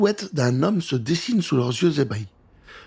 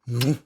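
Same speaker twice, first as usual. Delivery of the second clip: smack a pretend kiss; kiss noise; 5 semitones lower than usual. Gab teasing Rose with a fake kiss, smacking kiss sound.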